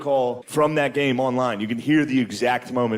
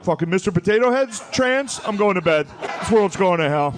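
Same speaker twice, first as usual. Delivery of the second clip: put-on high, indignant old-man outburst; mock outraged dad; 5 semitones higher, 3 dB louder than usual. Fox News dad storming off to bed, mock outraged. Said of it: "he'll just stand up and be like".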